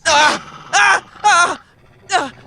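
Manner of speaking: pained screams. Acted. Struggling against a mind-controlled grip.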